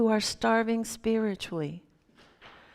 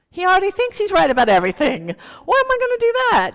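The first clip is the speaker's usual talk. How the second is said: fast, louder and much higher, rising to a squeak of outrage; indignant protest; 11 semitones higher, 9 dB louder, much faster than usual. Retold or imitated. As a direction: protest in disbelief, pitch climbing.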